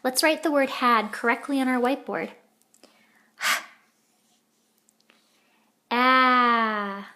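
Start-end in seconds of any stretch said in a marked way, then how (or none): 5.9-7.1 AD with a long drawn-out, louder A and separate D; sounded out. Shown as a demonstration. Teacher sounding out the word while writing, AD stretched slowly. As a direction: stretch the A in AD, then release the D.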